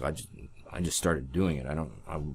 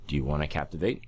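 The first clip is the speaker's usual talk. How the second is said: ordinary delivery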